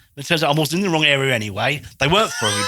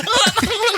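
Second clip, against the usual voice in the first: imitated mocking kid laugh.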